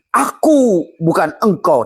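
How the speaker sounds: a high, drawn-out voice for the yellow rice. Acted. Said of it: "kata nasi kuning". (suara nasi kuning tinggi dan lambat)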